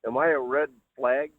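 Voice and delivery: ordinary delivery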